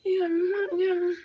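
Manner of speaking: soft, very high-pitched sweet cooing; gentle feminine charm. Acted. Tenant charming the landlord, gentle high feminine cooing. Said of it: "when I talk to my landlord".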